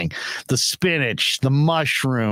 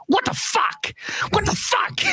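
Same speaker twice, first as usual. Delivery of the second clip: mock boss screaming, much higher; imitated scream. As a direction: shriek it like a furious boss overheard through the door. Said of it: "he just screaming".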